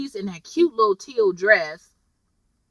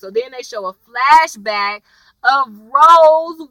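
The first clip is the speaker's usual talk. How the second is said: normal talking voice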